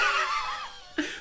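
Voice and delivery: high shrieking laugh. Real, not acted. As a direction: burst out in a squealing laugh. Story of mum's swear clip.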